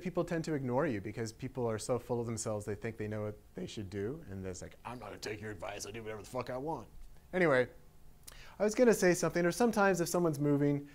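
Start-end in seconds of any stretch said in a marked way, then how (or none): 4.8-6.9 low, quick, under-the-breath retort; mock dismissive. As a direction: imitate someone brushing off advice, fast and quiet. Acting out people who ignore advice.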